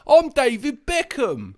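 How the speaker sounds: slow, hammed-up English accent; jokey English impression of a footballer; mock English accent. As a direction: play a cartoon Englishman, drawn out. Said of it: "made fun of an English person for their accent".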